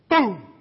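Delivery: a sudden, loud imitated impact; loud boom. Preacher telling kids' slingshot story, loud boom. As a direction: punch out the boom of the stone striking. Slingshot stone hitting the duck.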